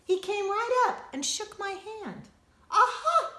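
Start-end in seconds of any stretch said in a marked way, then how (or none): 2.7-3.3 high, loud, laughing aha; excited aha. Acted. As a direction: burst out with delight. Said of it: "he said".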